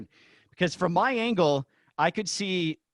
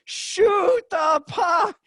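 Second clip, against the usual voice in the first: high, slow, drawn-out shout; retold yell; 6 dB louder, much slower than usual. Announcer urging Martinez at the open net, slow high shout. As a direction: stretch Shoot the puck into a pleading yell. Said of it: "I'm going".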